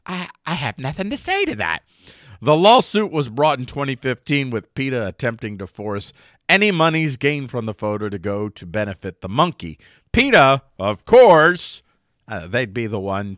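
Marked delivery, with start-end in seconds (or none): 0.0-1.8 high, snooty "I have nothing to say to that"; a higher, snippier take on the line; snippy dismissive retort. Pretend. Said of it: "That's my response here to that quote". 10.2-11.8 sarcastic emphasis. Acted. Sarcastic loud jab at peta.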